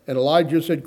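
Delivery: ordinary delivery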